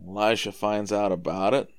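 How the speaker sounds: usual speaking voice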